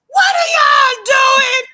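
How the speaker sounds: very high, drawn-out shout; yelling teacher. Retold or imitated. Teacher breaking up a kids' fight, shouting. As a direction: screech it out slowly like a scolding teacher.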